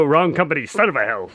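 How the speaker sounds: ordinary delivery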